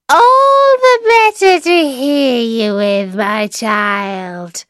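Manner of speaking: very high, loud start dropping at the end; slow, crowing disguised wolf; wolf as grandmother. A narrator's reading.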